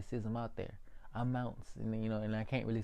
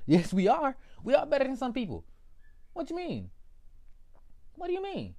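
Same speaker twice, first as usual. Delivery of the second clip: indignant outburst; 8 dB louder than usual. Acted. Indignant retort, very high loud.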